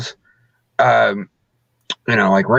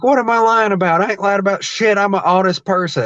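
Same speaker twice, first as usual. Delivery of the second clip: mock indignant heel; 6 semitones higher, faster than usual. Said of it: "I'm like".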